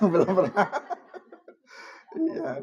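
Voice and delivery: giggling playful question and answer; laughing. Real, not acted. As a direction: laugh while you tease the ladies. Teasing the women in audience.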